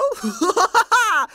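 high teasing villain laugh; mocking laugh. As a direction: laugh at the confused hero. Boss mocks confused hero.

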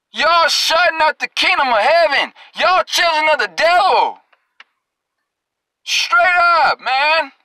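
fiery rebuke to the hypocrites; preaching at full force. Acted. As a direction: call them out, verse by verse.

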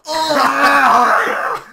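loud shocked cry then laughter; shocked shout. Real, not acted. Learns crackers from 2008.

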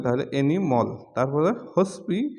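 usual speaking voice

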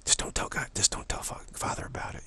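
whispered aside, coaxing like a mother slipping a sweet; conspiratorial whisper. Retold or imitated. Mother secretly whispering to the child about a sweet. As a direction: hush it, don't let father hear. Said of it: "here's the mother saying".

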